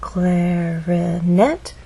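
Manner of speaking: "clarinet" drawn out slowly, gliding up on the last syllable; stress on final syllable. Shown as a demonstration. Teacher breaking down three syllables, stressed rising final syllable. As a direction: stretch "clarinet" syllable by syllable and swoop up on its ending. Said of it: "it's the final syllable that is stressed".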